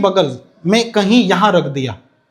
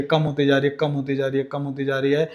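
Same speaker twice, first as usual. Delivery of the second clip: fading away; 6 semitones lower, 8 dB quieter than usual. Shown as a demonstration. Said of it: "force इस पे लगनी कम होती जा रही है".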